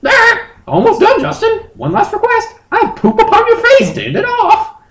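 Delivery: very high falsetto, breaking into a laugh; squeaky creature voice, giggling at the end; high Moltres voice. Acted. Moltres instructing Justin, high and giggly.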